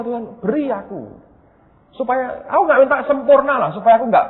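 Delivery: high pleading cry to God; begging God with a breaking voice; tearful prayer. Retold or imitated. Retelling his tearful prayer to God, high pleading. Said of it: "Nangis, saudara".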